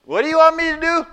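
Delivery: high, loud anxious shout; calling up. Acted. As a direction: holler up nervously. Hanging man calling up.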